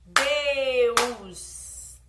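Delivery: "Deus" said loud and drawn out; syllable count demo. Shown as a demonstration. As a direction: clap out "Deus" loudly as one beat. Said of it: "Vamos contar as sílabas". ("Deus" alto, batendo palma)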